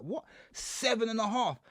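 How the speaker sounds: can't believe the number you just heard; shocked disbelief. Retold or imitated. Man hearing his prison sentence in court, shocked disbelief.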